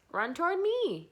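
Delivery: high, loud gander yell. A narrator's reading. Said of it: "yelled the gander".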